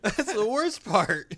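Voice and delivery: high, laughing. Real, not acted. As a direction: say it through a laugh. Laughing at the story.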